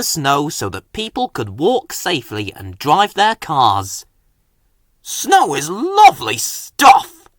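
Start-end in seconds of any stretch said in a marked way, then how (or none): 5.0-7.3 higher, slow happy tone; enjoy every word, slowly and merrily; cheerful. A narrator's reading. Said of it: "said Terence cheerfully".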